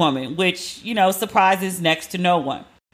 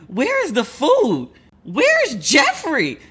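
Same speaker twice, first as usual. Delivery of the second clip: high, slow wail of a spoiled son; whiny Carlton; 7 semitones higher, slower than usual. Retold or imitated. Carlton finding the fridge empty, high slow wail. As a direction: stretch it out, high and helpless. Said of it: "he was like".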